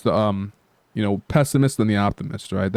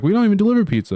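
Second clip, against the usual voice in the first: high, louder indignant protest; indignant Squidward; 11 semitones higher, 4 dB louder, much faster than usual. Retold or imitated. Vlogger retelling Squidward refusing to deliver, high indignant protest. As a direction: protest it sharply, high and put out.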